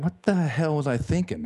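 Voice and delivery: high, incredulous self-question; dismayed disbelief. Retold or imitated. Worn-out runner lost in dark woods, incredulous at himself. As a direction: regret hitting mid-race.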